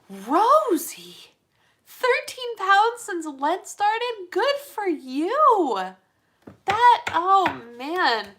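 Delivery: high, loud, drawn-out delighted cheer; thrilled congratulations. Real, not acted. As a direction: celebrate their news, stretching the words high. Congratulating viewer on weight loss.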